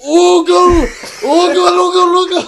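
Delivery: very high, drawn-out holler of "oogle"; imitated screaming. Mimicking the family screaming at a store speaker, high mock scream. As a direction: scream it without the G, over and over. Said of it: "hear them screaming at it".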